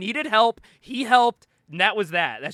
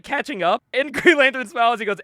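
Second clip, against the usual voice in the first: usual speaking voice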